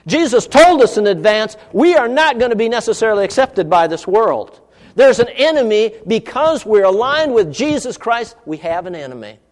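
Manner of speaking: loud, high, emphatic pulpit delivery; forceful preaching. Real, not acted. Preacher warning believers they have an enemy, forceful preaching. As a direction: drive the warning home to the congregation.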